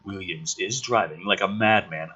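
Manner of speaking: plain reading voice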